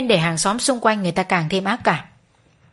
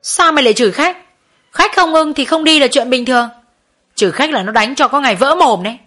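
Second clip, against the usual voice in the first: higher, louder furious telling-off; loud raised-voice reprimand; angry scolding; 4 semitones higher, 4 dB louder than usual. Acted. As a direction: tear into her for insulting a customer. Boss scolds worker for cursing customer.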